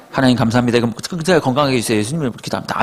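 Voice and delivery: hurried, mumbled mock grace; low-key rote prayer, rushed through; eight-second prayer. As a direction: rattle off a perfunctory prayer. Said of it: "딱 정확하게 팔 초".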